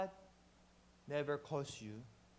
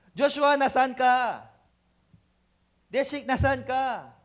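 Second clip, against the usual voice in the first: louder, slow call across a room; 4 semitones higher, 8 dB louder, slower than usual. Acted. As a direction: call out the names, drawn out. Calling out names. (malakas at mabagal na pagtawag)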